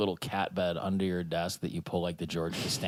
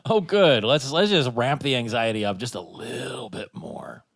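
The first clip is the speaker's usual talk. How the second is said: dripping with sarcasm; mock delight; 2 semitones higher, 5 dB louder than usual. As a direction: feign cheerful approval, then ease down. Mock reaction to anxious in-flight viewing.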